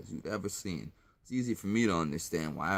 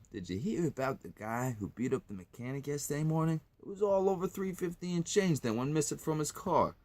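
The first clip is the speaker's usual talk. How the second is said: lightly voiced gossip, higher pitched; gossiping local; 7 semitones higher than usual. Local retelling the mechanic's beating to someone, lightly voiced gossip. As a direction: pass on local news in a higher voice. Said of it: "you may hear".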